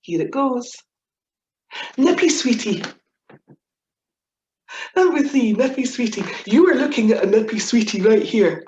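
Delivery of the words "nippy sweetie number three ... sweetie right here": excited announcement. Real, not acted. Presenting word of the day.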